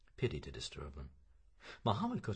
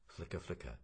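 ordinary delivery

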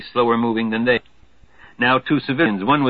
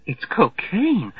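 rising alarm; 8 semitones higher, much slower than usual. Acted. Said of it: "the girl's voice rising".

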